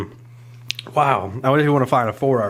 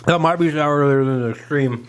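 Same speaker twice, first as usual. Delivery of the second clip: normal talking voice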